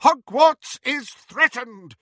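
high, loud, slow proclamation; rallying shout. A narrator's reading. Professor rouses the statues to defend the school, shouting. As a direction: shout it slowly to the whole castle. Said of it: "shouted Professor McGonagall".

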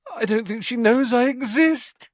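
high, loud lovesick despair; desperate. A narrator's reading. Lovesick Ron fears she doesn't notice him, desperate. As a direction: wail it in desperation, pitched high. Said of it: "with a desperate gesture".

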